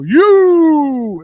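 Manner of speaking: long, loud, high drawn-out holler. Acted. Co-host teasing how to answer [name], hollered impression. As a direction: belt it out high and stretched like a rap ad-lib. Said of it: "your best [name] impression".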